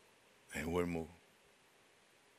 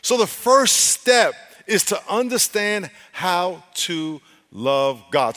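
high, loud, emphatic sermon delivery; full-force preaching; 9 semitones higher, 11 dB louder than usual. Real, not acted. Preacher pressing the congregation to love God first, full-force preaching. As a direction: drive each point home to the congregation.

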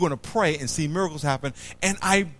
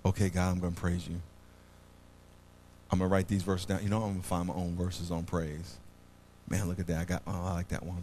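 muttering to oneself; 11 semitones lower, 10 dB quieter, faster than usual. Retold or imitated. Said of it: "and write down a".

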